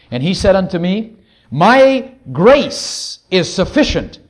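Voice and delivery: slow, loud declamation with drawn-out key words; scripture reading. Acted. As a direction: proclaim the verse weightily, word by word. Reads scripture to the congregation.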